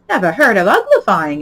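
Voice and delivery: loud exclaiming; scandalized loud outburst; exclaimed. A narrator's reading. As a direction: blurt it out in loud disbelief. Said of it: "it exclaimed".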